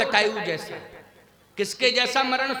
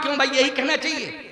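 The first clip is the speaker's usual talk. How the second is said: ordinary delivery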